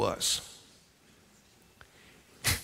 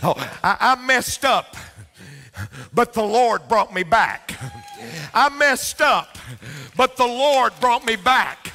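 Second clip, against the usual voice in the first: preaching at full force; 10 semitones higher, 5 dB louder than usual. Acted. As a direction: shout the refrain ever higher. Preaching climax with laugh.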